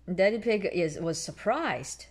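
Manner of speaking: usual speaking voice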